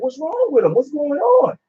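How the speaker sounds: a touch of mock alarm; alarmed movie quote. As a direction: play the confused aliens asking what's wrong. Acting out aliens in a movie.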